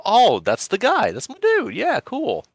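high-pitched, loud mock-delighted realisation; excited recognition. Fan acts out spotting a familiar voice actor, hammed-up excited. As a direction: act out a thrilled "that's him!" moment, voice leaping up.

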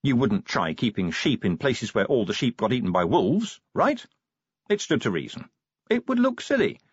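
ordinary delivery, nothing marked

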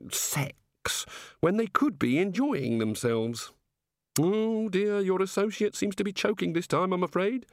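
high, slow, innocent god voice; puzzled politeness from a naive deity; curious, genial god. Acted. God remarking on the wizards' odd reactions, genially curious.

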